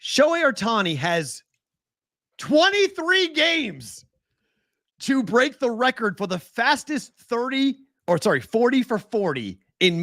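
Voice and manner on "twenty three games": twenty-three games drawn out high and slow; stressed slowly. Real, not acted. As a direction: lean on every word of twenty-three games. Stressing an unbelievable stat.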